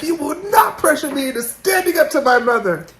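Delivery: high-pitched, hammed-up protest; mock indignant refusal. Acting out his indignant refusal.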